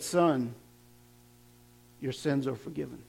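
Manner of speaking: tender. Acted. Jesus' words quoted softly.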